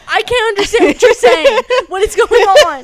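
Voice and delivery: high, loud, laughing confusion; confused and laughing. Retold or imitated. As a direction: squeal through laughter, baffled. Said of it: "I was like".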